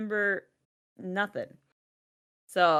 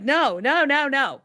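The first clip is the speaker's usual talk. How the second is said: higher and louder rushed denial; urgent no no; 7 semitones higher, 5 dB louder, slower than usual. Retold or imitated. Reassuring daughter who feels forgotten, urgently higher and louder. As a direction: cut her off fast with reassurance.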